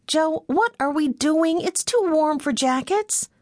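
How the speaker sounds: high, slow voice, demanding what is going on; puzzled Meg. A narrator's reading. Meg questions Joe, puzzled.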